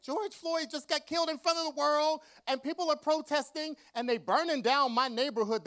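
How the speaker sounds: high, loud protest; outraged yell. Retold or imitated. Author yelling at publisher about the riots, loud and high. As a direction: yell it in disbelief. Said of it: "And I was like".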